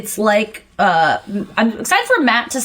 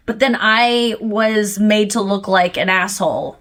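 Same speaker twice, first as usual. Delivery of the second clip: usual speaking voice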